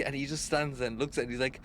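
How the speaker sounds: ordinary delivery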